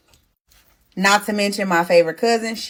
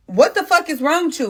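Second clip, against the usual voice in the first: high, sharp angry yell at a sleepy driver; 9 semitones higher than usual. Retold or imitated. Pregnant woman yelling at her drowsy boyfriend, angry. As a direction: snap at him in shock. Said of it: "now I'm mad".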